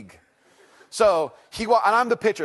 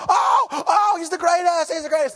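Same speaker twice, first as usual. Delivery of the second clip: high, loud overjoyed cheer; exploding with joy; 13 semitones higher, 6 dB louder than usual. Retold or imitated. Dad cheering his kid's hit at the ball game, exploding with joy. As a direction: gush ecstatically at the top of your range. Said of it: "I nearly exploded with joy".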